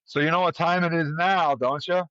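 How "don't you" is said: ordinary delivery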